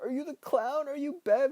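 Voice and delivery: high, panicky questions; frightened. Acted. Ben freaking out at Beverly, high frightened questions. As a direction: plead in fear. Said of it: "and he's like".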